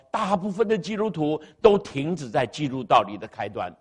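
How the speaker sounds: ordinary talk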